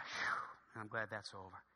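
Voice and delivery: soft, low sigh of relief; deep sigh. Acted. Gate agent relieved the rush is over, deep sigh. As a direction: breathe out, then speak low and spent. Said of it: "she gave a deep sigh".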